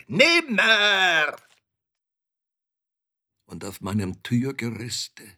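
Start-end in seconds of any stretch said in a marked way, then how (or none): 0.1-1.4 very high, loud raven screech; piercing raven's cry; croaking raven. A narrator's reading. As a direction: croak it higher and louder, long and falling. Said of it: "krächzte da der Rabe".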